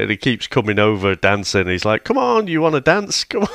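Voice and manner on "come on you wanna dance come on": high-pitched, playful goading; mock coaxing. Retelling a character pestering Freddy to dance, mock coaxing. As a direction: pester someone to join in, voice lifted.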